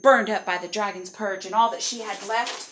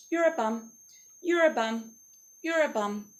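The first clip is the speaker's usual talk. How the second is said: imitated daughter. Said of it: "my daughter would walk around ... going".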